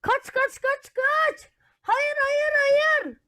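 high, frantic run run; terrified fleeing shouts; panicked cry. Acted. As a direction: yell to flee in fright.

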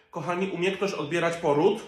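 ordinary delivery

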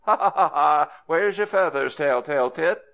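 high, loud mocking cry; loud, high-pitched teasing shriek; shrieking taunt. A narrator's reading. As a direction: shriek out a jeering ha-ha-ha, then the taunt. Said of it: "shrieked out at the top of his voice".

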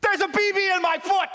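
high, shouted revelation; triumphant outcry. Retold or imitated. Boy revealing what the x-ray showed, triumphant outcry. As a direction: cry it out in vindicated disbelief.

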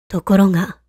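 ordinary delivery

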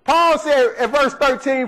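ordinary talk, nothing marked

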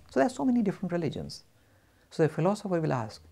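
ordinary delivery

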